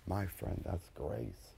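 a near-whisper of conviction; hushed intimacy. Acted. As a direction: speak it close to one listener. Intimate close.

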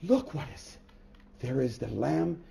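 slow, hushed astonishment; awed gasp. Retold or imitated. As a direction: breathe it out in wonder. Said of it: "looks and he says".

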